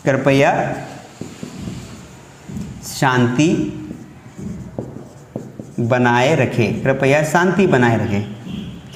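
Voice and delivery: teacher reading a sign aloud; slow dictation. Shown as a demonstration. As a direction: read out the notice slowly. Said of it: "ये sentence आपने जरूर सुना होगा".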